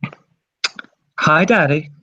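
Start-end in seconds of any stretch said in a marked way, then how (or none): 1.2-1.9 sweet daughter voice. Acted. Daughter greets father on phone.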